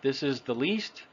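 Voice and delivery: "least" with a long ee vowel; "this is the least", tense ee; long E sound. Shown as a demonstration. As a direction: say "least" with ee as in "see". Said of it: "I said the first one there".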